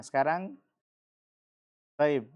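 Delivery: ordinary talk, nothing marked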